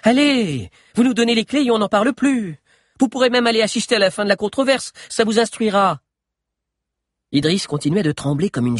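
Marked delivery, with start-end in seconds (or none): 0.0-6.0 higher, louder coaxing appeal; smooth things over; conciliatory. A narrator's reading. Minister persuading the guards, conciliatory. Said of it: "en signe de conciliation".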